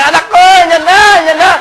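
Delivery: high, loud excited calling; mother shouting. Retold or imitated. Mother announcing her son to the village, high loud shout. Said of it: "sigaw ng sigaw nanay".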